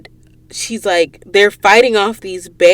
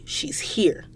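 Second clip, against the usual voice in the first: soft unvoiced whisper of grandma; whispered warning; 2 semitones lower, 12 dB quieter than usual. Grandma warning Bree from inside her mind, whispered. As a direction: breathe it like a voice in her head. Grandmother's voice warns in Bree's head.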